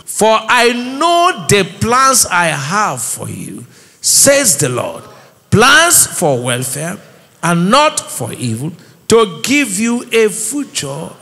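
slow, measured Bible reading; deliberate recitation, phrase by phrase; scripture reading aloud. Real, not acted. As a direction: read it out slowly for a congregation to follow. Reads scripture aloud with congregation.

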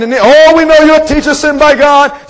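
high, loud mock flattery; flattering. Nicodemus buttering up Jesus, flattering. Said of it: "Nicodemus tried to flatter".

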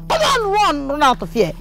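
high, urgent scolding cry; angry chasing-off. Acted. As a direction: send them running. Chasing off the caught girl.

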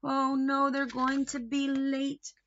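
plain reading voice

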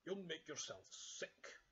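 mild parental scolding, drawn out; scolding Father Bear. Acted. Father Bear warns cub in revolving doors, mild slow scolding. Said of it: "cried Father Bear".

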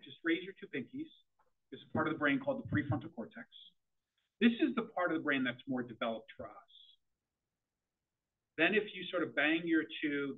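normal talking voice

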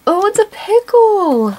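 discover it with a happy shock; delighted surprise. Real, not acted. Vlogger finding out the last toy is a pickle, surprised.

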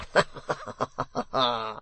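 rapid run of ha-ha-has; rough laugh. A narrator's reading. Hugh laughing after demanding livelier work, rough laugh. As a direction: laugh it out in character.

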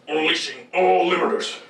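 steady mechanical read; robot announcer voice. Shown as a demonstration. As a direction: say it straight like a machine. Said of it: "What did I just say?".

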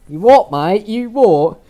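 loud, high-pitched mock British hooligan; British accent. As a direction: square up for a fight in a hammed-up British accent. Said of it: "That British accent".

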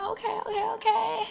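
high, giddy okay okay okay; bubbling over at the new orders; excited okays. Retold or imitated. Seller giddily reacts to a stream of late orders.

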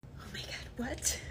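very soft whisper; muttered disbelief. Acted. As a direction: say it under your breath. Customer mutters after being scolded.

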